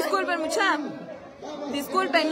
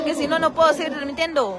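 ordinary delivery

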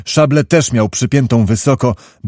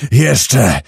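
a sharp call for more water; the soaked colonel shouts for more; shouted demand. A narrator's reading. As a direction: cry out for another bucket. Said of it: "zakrzyknął".

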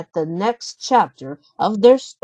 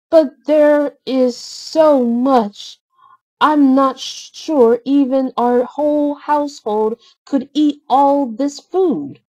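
a hint of Belle, pitched up and unhurried; 6 semitones higher, 4 dB louder than usual. Narrator voices Belle in story.

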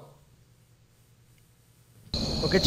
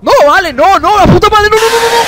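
terrified yelling, high and loud; panicked screaming; 14 semitones higher, 11 dB louder than usual. Real, not acted. Jumpscare in horror game.

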